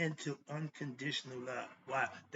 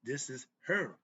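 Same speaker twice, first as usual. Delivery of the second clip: ordinary delivery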